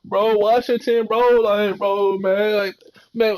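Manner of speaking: high, drawn-out mock complaining voice; imitating how players talk. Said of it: "that's how they talk".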